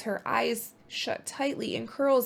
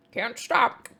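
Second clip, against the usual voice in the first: lightly voiced busy beaver, a bit louder; a hint of a hurried beaver; 4 semitones higher, 5 dB louder than usual. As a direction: toss it off as a beaver too busy to stop. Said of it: "mutters Beaver".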